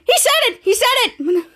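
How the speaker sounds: high, excited retold exclamation. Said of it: "To Volt excited".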